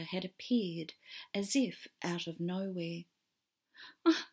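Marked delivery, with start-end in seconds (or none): 4.0-4.2 high, sobbing broken words; crying. Acted. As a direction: choke out the words through tears. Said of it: "crying too hard to speak".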